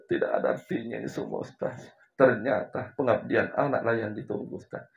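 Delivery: tearful confession. Retold or imitated. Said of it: "Langsung dia menangis".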